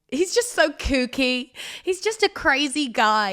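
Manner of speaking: playfully exasperated; fond exclamation. Real, not acted.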